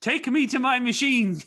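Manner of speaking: loud, high-pitched eager cry; excited demand. Retold or imitated. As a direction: burst out as if you can't wait. Retold eager demand on arrival.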